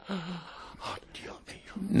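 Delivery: a breathless, whispered oh my God; whispered dread. Retold or imitated. Singer recalling stage fright before the orchestra, whispered dread. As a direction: gasp it out in remembered panic.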